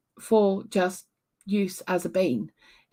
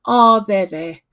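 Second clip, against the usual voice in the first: mummy voice; 8 dB louder, slower than usual. Retold or imitated. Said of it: "my mummy voice is just like".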